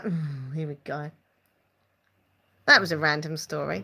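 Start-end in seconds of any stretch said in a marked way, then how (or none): 0.0-1.1 low, quiet, weary retold reaction; unimpressed aside. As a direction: mutter it low, as if fed up. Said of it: "And I'd be like".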